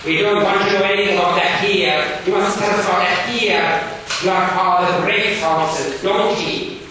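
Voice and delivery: loud, high, stern schoolmaster. Acted. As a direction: bark it like a strict Victorian teacher. Gradgrind voiced in reading.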